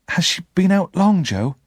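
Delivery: put-on anxious boy, pitch rising high on the question; nervous question. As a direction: ask it worried, afraid of the answer. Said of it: "I asked nervously".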